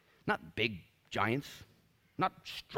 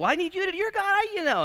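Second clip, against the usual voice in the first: very high, loud mock protest; panicky protest; 9 dB louder than usual. Believer argues back at God, squealing in mock exasperation.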